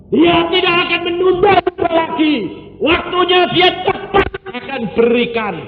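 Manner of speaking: high, loud preacher's cry of thanks; fiery preaching. Acted. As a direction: preach it out at the top of the voice. Preaching at full force. (khotbah berapi-api dengan suara tinggi dan keras)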